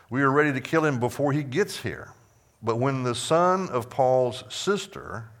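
projected, emphatic Bible reading; scripture reading. Acted. Teacher reading the conspirators' oath to the class, emphatic scripture reading. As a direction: read it out firmly, punching the key words.

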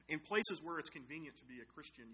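ordinary delivery

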